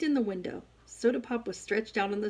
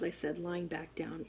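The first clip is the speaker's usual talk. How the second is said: ordinary delivery, nothing marked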